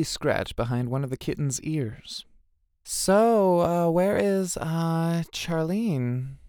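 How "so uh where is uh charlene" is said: high, hesitant, drawn-out question; awkward asking. A narrator's reading. Tara asking where Charlene is, awkward. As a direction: feel your way in with stretched ums.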